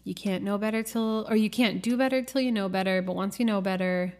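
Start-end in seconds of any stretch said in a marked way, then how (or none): none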